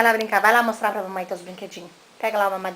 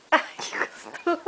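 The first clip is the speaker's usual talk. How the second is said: breathy, slow aww; 5 semitones higher, slower than usual. Real, not acted. Mother reacting to baby kiss.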